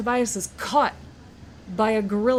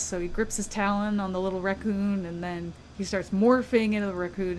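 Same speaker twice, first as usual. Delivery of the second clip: ordinary talk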